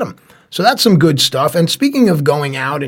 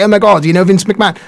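louder, higher, quick British fanboy gush; mock British accent; 5 semitones higher, 6 dB louder, faster than usual. Host teases co-host about wrestling stars, mock British accent. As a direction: hurry it out in a teasing British accent. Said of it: "you become British".

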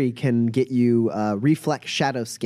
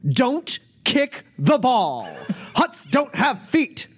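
booming announcer; 10 semitones higher than usual. Acted. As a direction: boom it out like a showman. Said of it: "you hear a booming voice, uh, from somewhere outside this room, uh, shouting out".

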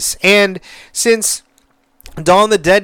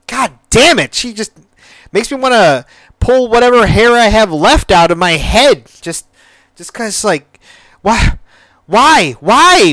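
raised, higher frustrated outburst; 6 semitones higher, 3 dB louder than usual. Real, not acted. Reviewer venting about the daughter, frustrated. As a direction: vent like you want to pull your hair out.

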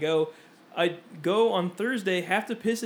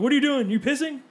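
higher, louder police questioning; mock cop challenge; 9 semitones higher, 6 dB louder than usual. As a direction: imitate a cop confronting a suspect. Imitating guns-drawn cops confronting him.

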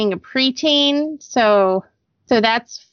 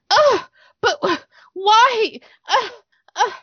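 whining daughter; 10 semitones higher than usual. Retold or imitated. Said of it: "I can't handle whining".

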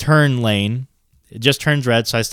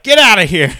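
raised, louder retold command; shooing yell; 6 semitones higher, 6 dB louder than usual. Driver with a leg in the car shooing seagull, retold yell. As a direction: shoo it off with a sharp push. Retelling second try at shooing.

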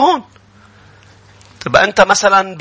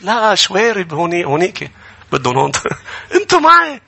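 ordinary talk

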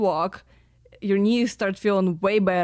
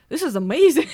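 thrilled, louder exclamation; excited; 6 semitones higher, 5 dB louder, faster than usual. Retold or imitated. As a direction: gush about it happily. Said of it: "and I was like".

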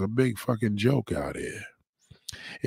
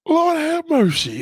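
higher and slow, stretched through a yawn; 6 dB louder, slower than usual. Real, not acted. Tired host mid-stream yawning out his catchphrase. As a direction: yawn right through the words. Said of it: "That was that yawn".

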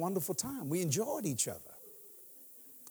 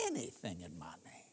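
hushed quote; 11 semitones lower, 13 dB quieter than usual. Acted. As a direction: let the promise sink into a whisper. Preacher quoting Jesus, dropping low.

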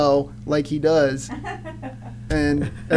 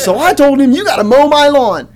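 higher, louder put-on country woman; indignant backwoods lady; 7 semitones higher, 8 dB louder than usual. Lady telling cops what she ordered her ex, mock indignant. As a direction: lay down the law in a mock rural voice.

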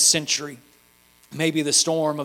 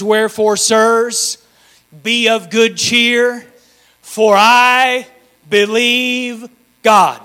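loud, drawn-out preacher's proclamation; preaching at full force; 6 semitones higher, 10 dB louder, much slower than usual. Acted. Preacher reading Paul's words to the standing church. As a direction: declare the verse like a herald. Preacher reads the verse over music.

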